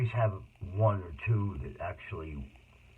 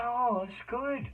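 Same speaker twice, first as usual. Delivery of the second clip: high, slow cheerful encouragement; drawn-out sunny reassurance; forced cheer, high and slow; cheery reply; much slower than usual. Retold or imitated. Upbeat visitor reply.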